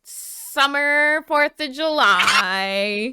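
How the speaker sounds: slow, drawn-out promo line; announcer voice. Pretend. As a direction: sell it like a summer sale. Slogan-like tagline.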